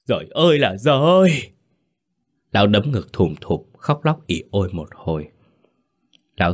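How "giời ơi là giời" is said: high, loud wailing cry; husband wails on hearing his young wife died; anguished wail. Acted. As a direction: howl it out in despair. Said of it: "Lão gào lên một tiếng thảm thiết".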